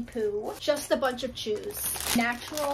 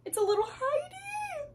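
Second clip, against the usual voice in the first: cooing. Real, not acted. Cooing over a tiny hideout.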